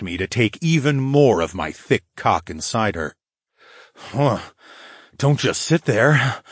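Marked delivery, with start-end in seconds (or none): 3.9-6.4 growled. Acted. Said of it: "I growled".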